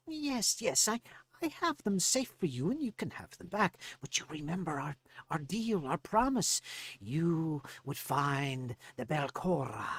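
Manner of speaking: high gnome voice, slowing at the end; placating gnome. Acted. Gnome reminds Farley of deal.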